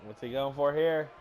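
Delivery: loud punchy move call; wrestling commentator. Acted. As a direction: bark the move name. Calls the move.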